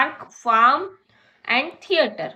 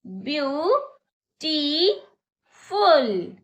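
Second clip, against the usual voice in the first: Beautiful stretched very slowly, syllable by syllable; slow demo; 3 semitones higher, much slower than usual. Shown as a demonstration. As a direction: spell out beautiful in slow beats. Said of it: "मेरे साथ बोलिए". (Beautiful को धीरे-धीरे खींचकर)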